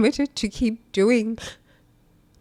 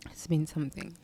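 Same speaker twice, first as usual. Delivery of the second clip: hushed whisper; 5 semitones lower, 11 dB quieter, faster than usual. Real, not acted. Emotional vlog, voice breaking.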